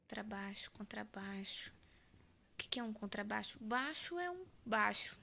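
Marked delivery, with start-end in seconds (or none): 0.0-1.7 whispering. Real, not acted. Muttering the word to himself.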